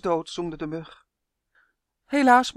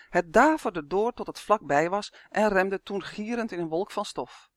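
plain reading voice

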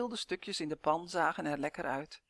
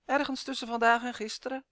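lispelend; 6 semitones higher, 4 dB louder than usual. Acted. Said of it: "lispelde Uf".

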